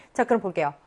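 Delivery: normal talking voice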